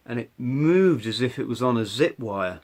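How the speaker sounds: normal talking voice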